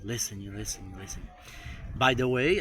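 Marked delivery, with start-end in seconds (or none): 0.0-1.3 listening said softer and lower in English sounds; soft repeated practice of listening; English pronunciation. Shown as a demonstration. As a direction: drill listening quietly, English style. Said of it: "pratiquei bastante o listening".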